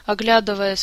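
ordinary delivery, nothing marked

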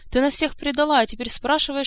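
high, loud accusation; acted shrieky outrage; outraged squeal. A narrator's reading. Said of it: "взвизгнула графиня Манкар".

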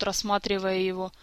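ordinary delivery, nothing marked

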